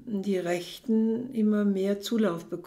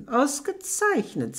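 smiling satisfaction; 7 semitones higher than usual. Acted. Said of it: "sagt der Lagerführer lächelnd".

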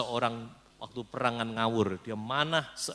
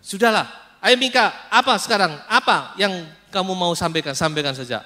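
impatient demand; 7 semitones higher, 11 dB louder than usual. Acted. Ahab impatiently demands Mika speak.

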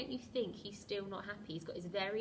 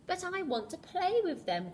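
high, loud wail of a let-down crocodile; crying out; 11 semitones higher, 8 dB louder than usual. Acted. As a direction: cry out like a sulking child. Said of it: "cried the crocodile".

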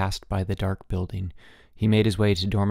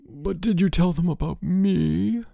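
high, slow, groaning plea; groaning sick boy; 9 semitones higher than usual. A narrator's reading. Sick boy groaning at his roommate for help. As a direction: groan it out from the sickbed. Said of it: "George groaned".

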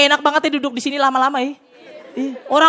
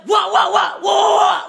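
higher, louder mechanical wah shouts; piano-key crowd shout; 6 semitones higher, 4 dB louder, slower than usual. Shown as a demonstration. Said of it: "Pakai piano tinggal dipencet".

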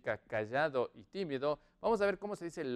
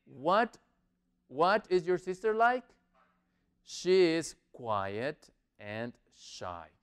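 ordinary talk

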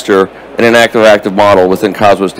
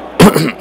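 clears his hoarse throat; a quick rough throat clear; 5 semitones higher than usual. Real, not acted. Speaker losing voice mid-talk.

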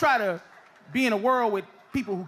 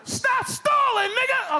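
mock gunman yell; 12 semitones higher, slower than usual. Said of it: "gunman yelling".

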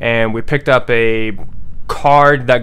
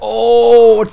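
startled; 10 semitones higher, 10 dB louder than usual. Real, not acted. Startled by noise in horror game.

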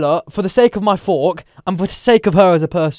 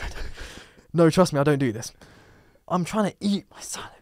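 ordinary delivery

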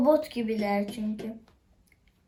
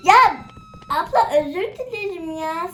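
flustered apology; 7 semitones higher, 8 dB louder than usual. Acted. Apologizes to game character.

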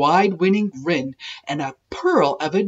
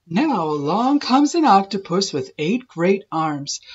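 usual speaking voice

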